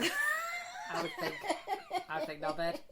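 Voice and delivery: laughing through the words. Real, not acted. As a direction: squeak with laughter as you say it. Laughing mid-story.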